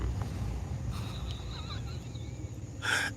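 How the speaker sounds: airy, high wheezing laugh; breathy silent laugh. Real, not acted. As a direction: laugh without voice, all breath. Laughing at own joke.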